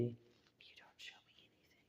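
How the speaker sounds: breathy whispered put-down. Real, not acted. Answering the person who challenged Jacob, whispered scoff. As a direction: hiss it under your breath. Scoffs back at a retold boast.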